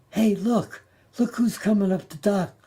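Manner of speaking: high, mildly excited call-out; retold yell. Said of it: "somebody yells out".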